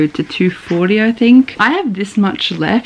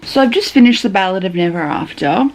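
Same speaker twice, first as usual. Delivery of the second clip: normal talking voice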